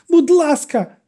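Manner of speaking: desperate plea, screamed; cried out. A narrator's reading. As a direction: beg at the top of her voice. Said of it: "викрикнула вона".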